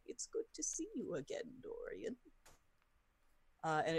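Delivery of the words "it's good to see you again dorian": high eerie floating skull. Acted. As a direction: whisper it up high like a ghostly skull. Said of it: "And he's like".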